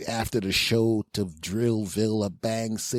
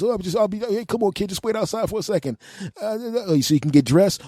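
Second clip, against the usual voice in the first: awkward high voice; 11 semitones higher, 3 dB louder, faster than usual. Retold or imitated.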